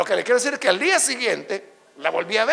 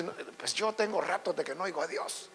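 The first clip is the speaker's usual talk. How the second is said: very soft, low aside; sheepish admission; 5 semitones lower, 14 dB quieter than usual. Acted. Believer who feels left out by God's favorites, quiet sheepish admission. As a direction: shrink back and confess it quietly. Overlooked believer answers the boasters.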